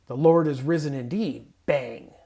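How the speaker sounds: loud, emphatic with a punched "bang"; exclamation bang. Shown as a demonstration. Pastor acting out the exclamation mark, loud. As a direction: stamp the end with "bang". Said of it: "typesetters called it... a bang".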